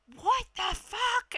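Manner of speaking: high, slow stunned outburst; a shocked yell across the parking lot. Retold or imitated. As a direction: let it rise in disbelief. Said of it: "he was like".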